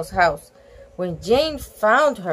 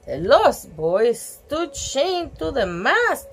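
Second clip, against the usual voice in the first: plain reading voice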